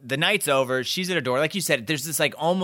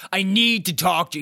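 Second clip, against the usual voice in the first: raised, louder mock yell; imitated yelling; 5 semitones higher, 5 dB louder than usual. Boyfriend barging up to talk to her, mock yelling. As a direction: blurt it out as a semi-yelled demand. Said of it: "He's, like, yelling".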